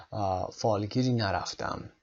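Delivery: ordinary talk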